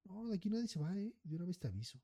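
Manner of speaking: high, mock menacing threat; lightly voiced bully warning them off; threatening confrontation. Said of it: "vino y encaró al señor". (amenaza burlona, un poco aguda)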